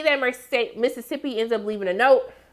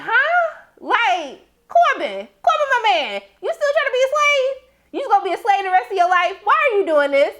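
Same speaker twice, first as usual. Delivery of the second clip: pitched way up in disbelief; incredulous high mock; 9 semitones higher, 3 dB louder than usual. Recapper scolding Corbin on screen, high and incredulous. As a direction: squeal it, scandalized and fast. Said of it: "I was like".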